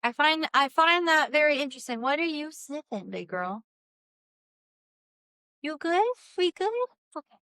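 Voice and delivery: pet talk. Real, not acted. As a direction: ask the dog gently. Talking to her dog.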